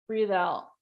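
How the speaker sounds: soft, unhurried meditation voice with long pauses; guided breathing. Real, not acted. Coach guiding a group to breathe in and out, soft meditation voice. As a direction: lead them gently through each breath.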